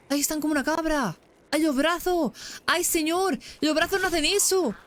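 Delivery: high, alarmed cries at the creepy arms; freaked-out shrieks. Real, not acted. Gamer shrieking at a creepy monster, high and alarmed. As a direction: squeal in horror at the twisting arms.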